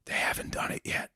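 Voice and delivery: unvoiced secretive whisper; mock Biden whisper. Host imitates Biden whispering about China. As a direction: drop to a whisper to make a point. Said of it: "let me whisper".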